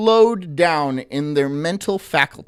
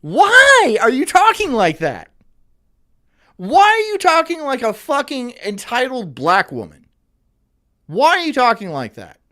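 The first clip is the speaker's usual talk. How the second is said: loud, high-pitched exasperated demand; raised voice, fed up with her way of talking; 8 semitones higher, 6 dB louder than usual. Real, not acted. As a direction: demand it loudly, drawing out the why. Exasperated reaction to the woman's clip.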